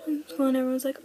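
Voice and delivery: ordinary delivery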